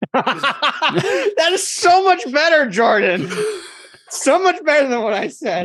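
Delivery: real laughter through high-pitched words; slow, high, cracking up with delight; laughing. Real, not acted. Player praising a friend's better comeback, laughing.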